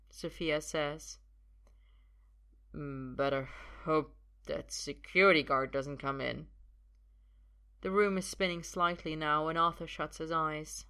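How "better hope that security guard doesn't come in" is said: put-on lightly slurred drunk man; woozy, halting worry; tipsy. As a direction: mumble it as if mildly drunk. Drunk Arthur, room spinning.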